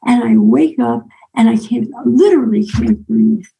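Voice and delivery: ordinary delivery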